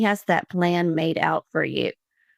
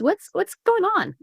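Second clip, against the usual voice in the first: worried dad, imitated; 14 semitones higher than usual. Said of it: "my dad looks at me and he goes".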